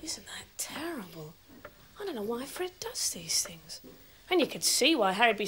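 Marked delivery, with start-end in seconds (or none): 0.0-3.8 soft whisper behind his back; whispered aside. Acted. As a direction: confide it so he can't hear. Aside kept from Harry.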